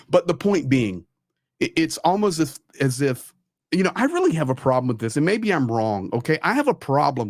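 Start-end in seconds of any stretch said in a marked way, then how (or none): none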